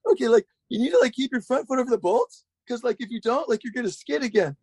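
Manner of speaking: high, voice-cracking Yoda-like coaching voice; Roddy Mullen Yoda voice. Retold or imitated. Roddy Mullen coaching the speaker over speakerphone, high cracking Yoda voice. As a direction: do Roddy as a squeaky cracking Yoda, all tech. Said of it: "kind of like a voice cracking version of Yoda".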